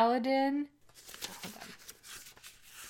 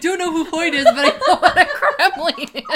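laughing; 11 semitones higher, 14 dB louder than usual. Real, not acted. Laughing hard mid-banter.

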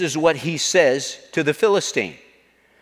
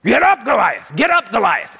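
raised, strained cheer; acted frantic shout, pitched up; urgent crowd yell; 5 semitones higher, 3 dB louder than usual. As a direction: holler at your fallen champion. Acted crowd urging fallen champion.

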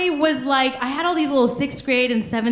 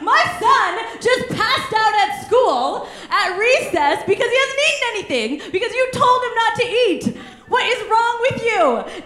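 chewing out; 8 semitones higher than usual. Retold or imitated. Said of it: "She chewed me out".

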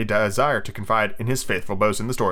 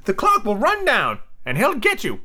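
high, slow sidekick voice; high-pitched henchman; Smee; 10 semitones higher than usual. Acted. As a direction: warn the captain in a high drawl. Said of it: "said Smee".

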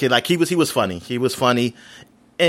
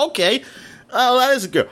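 pitched-up mock "oh" of realization; retold surprise; 9 semitones higher than usual. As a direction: play the dawning surprise, voice lifted high. Retold realization the kid is a girl.

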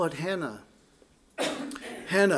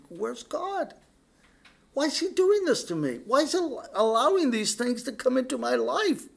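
much higher, pleading whine of a doubter; anguished cry; 9 semitones higher than usual. Retold or imitated. A struggling believer asks God why, high and pleading. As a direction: cry out like someone feeling abandoned.

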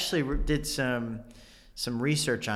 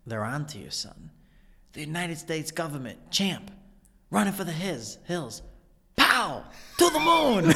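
Christopher Walken impression; 5 semitones higher than usual. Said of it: "mine from that scene is when he goes".

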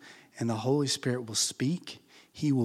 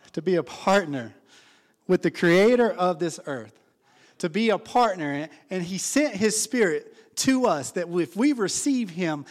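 louder, higher fervent preaching; 6 semitones higher, 7 dB louder than usual. Acted. Pastor praising partnership with God, fervent loud preaching. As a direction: lift the room with it.

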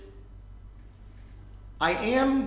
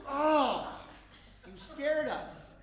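startled; 9 semitones higher, 4 dB louder, much slower than usual. Acted. Man startled by brother bursting in, high startled yelp. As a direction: jump out of your skin, then catch breath.

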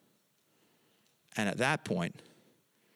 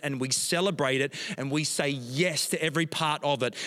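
rising prayer; 3 semitones higher, 4 dB louder than usual. Acted. Pastor praying over the congregation, building to a loud climax. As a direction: pray with mounting passion for the church.

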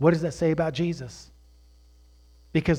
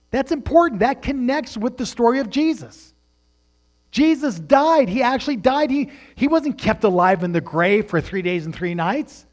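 high and loud sermon climax; fiery preacher proclaiming the resurrection; preaching at full force; 7 semitones higher, 7 dB louder than usual. Acted. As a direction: proclaim it to the whole church. Preaching climax about Jesus.

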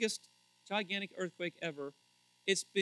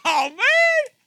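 very high, loud wail; outraged outcry; 19 dB louder than usual. Acted. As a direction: cry out in mock outrage. Outburst at brother's beach picture.

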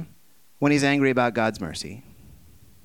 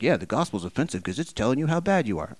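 mocking voice; faster than usual. Mocking a common saying.

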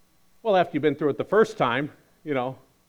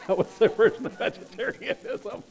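laughing; 7 semitones higher than usual. Real, not acted.

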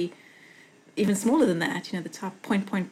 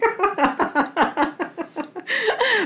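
loud high laugh; 12 semitones higher, 4 dB louder than usual. Real, not acted. Laughing while telling her friend the story, loud and high. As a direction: burst of high laughter at the memory.

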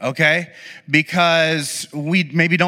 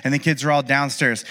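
normal talking voice

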